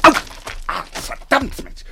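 sharp yelp, then cursing through the pain; pain after a fall. Acted. Doctor hits his shin on a motorbike, pained cursing. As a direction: bark your shin and swear.

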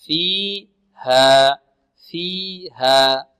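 very slow فيها, "fee" and "haa" evenly drawn out; exaggeratedly even "fee... haa" in فيها; measured equal vowels. Shown as a demonstration. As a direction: separate فيها into two matched long beats. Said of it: "لاحظتم الآن؟".

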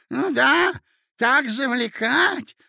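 high, loud eager echo; eager agreeing. A narrator's reading. Old prince eagerly chiming in behind Mozglyakov, high. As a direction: chime in eagerly. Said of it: "поддакивал князь".

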